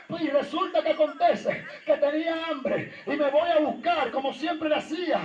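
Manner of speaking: ordinary talk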